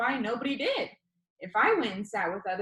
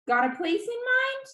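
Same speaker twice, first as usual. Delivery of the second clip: high, bright-voiced video host; upbeat YouTuber; 4 dB louder than usual. A narrator's reading. YouTuber Danny asks the viewer, upbeat and high. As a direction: pitch it up like an eager vlogger.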